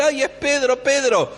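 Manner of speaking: high-pitched thrilled exclamation; excited Rode. Retold or imitated. Rode hearing Peter knocking at the door, excited. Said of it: "está tan emocionada".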